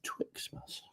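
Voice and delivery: a puzzled breathy whisper to himself; whispered repeat. Real, not acted. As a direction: whisper the word back under the breath. Husband whispers word back.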